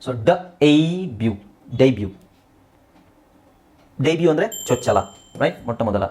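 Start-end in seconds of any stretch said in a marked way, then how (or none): 0.3-1.3 ಡ ಎ ಬ್ಯೂ in pieces, the "ay" held long; broken into sounds. Shown as a demonstration. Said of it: "ಡೇ ಅಂತ ಎಳಿಬೇಕು".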